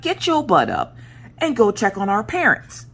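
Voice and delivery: high, insistent sibling command; retold bossy order. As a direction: re-enact ordering your sister around. Ordering sister to go check.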